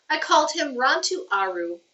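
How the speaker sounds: plain reading voice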